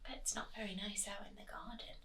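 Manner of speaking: quick hushed whisper. Acted. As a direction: whisper it to the kids. Whispered aside to children.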